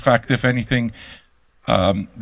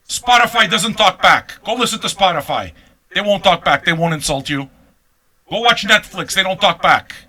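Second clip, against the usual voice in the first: mocking rant; 6 semitones higher, 3 dB louder than usual. Real, not acted. Mocking listeners who want politeness.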